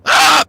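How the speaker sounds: sharp high scream of fright; sudden scream. Real, not acted. Gamer startled by the game, real high scream. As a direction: let out a startled shriek. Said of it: "I don't know why I scream so much now".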